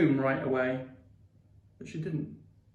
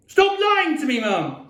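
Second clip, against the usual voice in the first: very high, loud outburst; shrieked; 13 semitones higher, 6 dB louder, slower than usual. A narrator's reading. Boy accusing Mum of lying, shrieking. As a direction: shriek it at your mother. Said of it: "I shrieked".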